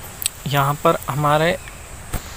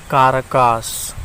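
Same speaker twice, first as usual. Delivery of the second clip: slow drilled name; much slower than usual. Shown as a demonstration. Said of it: "ऐसे करके याद रख सकते हैं आप".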